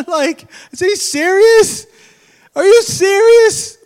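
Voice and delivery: high, slow incredulous cry; preacher hamming up disbelief; mock disbelief. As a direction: act out shocked disbelief.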